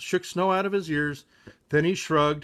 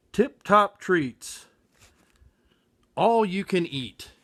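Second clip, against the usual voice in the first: dreamy sign read; 3 semitones higher, slower than usual. Acted.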